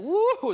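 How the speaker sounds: high-pitched drawn-out "woo"; a high whoop of amazement; exclaimed whoop. Shown as a demonstration. Preacher tells church about wild people, high whoop.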